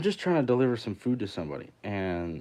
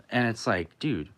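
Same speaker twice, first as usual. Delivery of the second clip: normal talking voice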